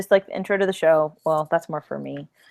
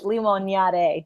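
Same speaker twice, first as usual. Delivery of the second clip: slow, limonade with Spanish vowels; Spanish accent; slower than usual. Shown as a demonstration. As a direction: draw out limonade like a native. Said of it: "say it with a proper accent".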